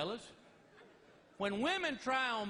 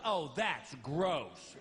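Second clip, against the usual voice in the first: slow, drawn-out disgusted wife; drawled-out wife's disgust; mock disgust; 8 semitones lower, much slower than usual. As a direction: imitate her grossed-out reply.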